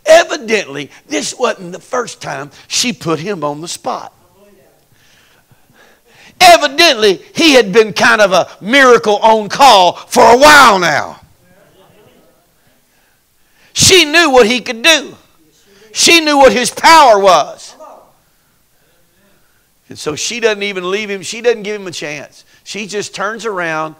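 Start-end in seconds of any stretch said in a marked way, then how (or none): 6.4-17.7 loud, high sermon shout; whooping preacher building up; preaching at full force. Acted. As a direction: lift the house with it.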